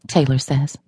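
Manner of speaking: ordinary delivery, nothing marked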